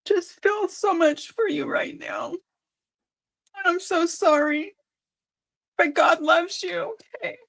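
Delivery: pleading through tears that God loves you; crying. Real, not acted. Comforting a hurting viewer, crying. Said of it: "don't mean to make you cry".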